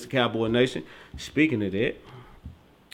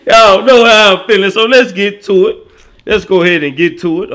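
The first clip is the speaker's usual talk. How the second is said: hype host; 5 semitones higher, 9 dB louder than usual. Acted. Hyped opener.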